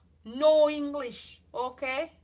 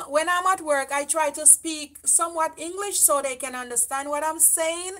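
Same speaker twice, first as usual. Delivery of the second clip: usual speaking voice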